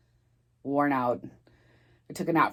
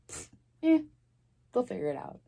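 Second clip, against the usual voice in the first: mock careless shrug, scoffing; callous dismissal; 7 semitones higher, 5 dB quieter, faster than usual. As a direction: imitate someone brushing it off with a scoff. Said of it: "and just be like".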